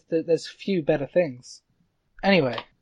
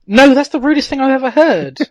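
offended woman; 7 semitones higher, 11 dB louder than usual. Retold or imitated. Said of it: "She says".

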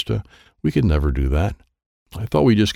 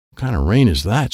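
ordinary delivery, nothing marked

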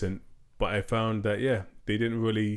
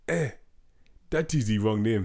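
deliberately bad mock French accent; 3 dB louder than usual. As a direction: put on a hammy, badly faked French accent. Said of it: "I purposely did a really bad accent".

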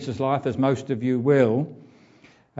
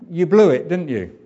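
louder, reproachful you blew it; a hint of telling off a failed friend, louder; mock scolding; 3 semitones higher, 7 dB louder than usual. Preacher imagining scolding Peter, louder and mock stern. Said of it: "if it had been me".

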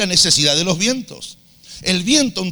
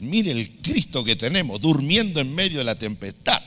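ordinary talk, nothing marked

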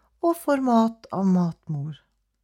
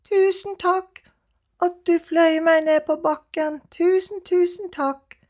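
higher grateful little cat voice; 9 semitones higher, 4 dB louder than usual. Acted. Elle thanks the crow for the ride, higher grateful voice. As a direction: thank him warmly, higher.

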